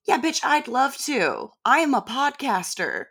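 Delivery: high, mocking sarcasm; sarcastic retort. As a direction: scoff at the book's advice in a high voice. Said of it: "and being like".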